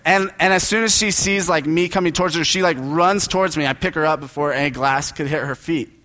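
ordinary delivery

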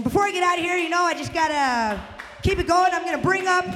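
high, loud hype intro. Acted. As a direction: pump up the crowd. Hosting, bringing up next comics.

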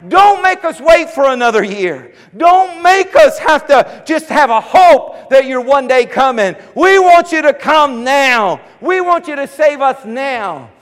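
high, loud pleading cry; crowd crying out. Retold or imitated. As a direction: beg the Messiah to come now. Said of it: "They're saying".

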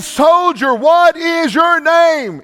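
high, loud, slow commanding yell; screamed demand. Retold or imitated. Alexander the Great screaming at the accused soldier, slow loud yell. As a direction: bellow the question like an angry general. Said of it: "And he said again".

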